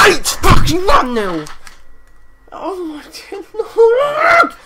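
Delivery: high, loud, slow wail with a laugh; real anguished yelling; disbelieving shout. Real, not acted. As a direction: howl at the score.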